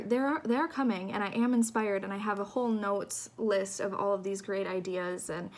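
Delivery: ordinary delivery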